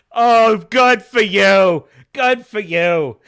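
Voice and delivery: mock furious on-set tirade. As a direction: spit it out with contempt.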